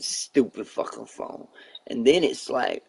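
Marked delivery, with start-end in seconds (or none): 0.0-1.5 grumbled curse. Retold or imitated. Grumbling at phone.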